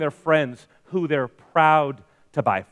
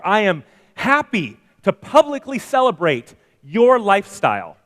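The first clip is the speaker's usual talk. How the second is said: ordinary talk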